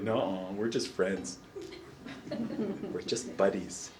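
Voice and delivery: low, soft, dragged-out brush-off; mock coy denial. Students asked about relationships, mock coy denial.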